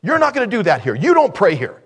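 loud, high, acted objection; imitated officious telling-off, punched out loud; scolding interruption. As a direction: cut in sharply to forbid it. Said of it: "suddenly this voice came".

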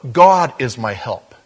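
"God" hit loud and drawn out, the rest falling away; stress on God. Shown as a demonstration. Preacher correcting where the emphasis falls, stress on "God". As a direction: lean hard and slow on the word "God". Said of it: "we put the emphasis on God".

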